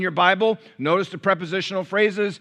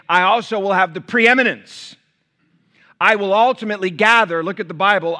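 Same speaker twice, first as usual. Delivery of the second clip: full-force preaching; 4 semitones higher, 6 dB louder than usual. Acted. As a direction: proclaim Christ's words to the whole church. Preacher proclaims words of Jesus.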